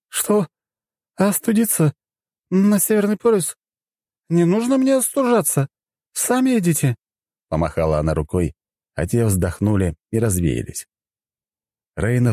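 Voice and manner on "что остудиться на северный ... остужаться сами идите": high, huffy tipsy voice; indignant drunk goddess. Acted. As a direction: brush them off, offended. Goddess refuses, waves off.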